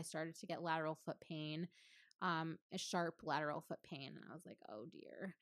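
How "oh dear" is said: whispered, low aside to herself; hushed dismay. Retold or imitated. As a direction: murmur a small, sinking realization under your breath. Retold reaction to foot pain.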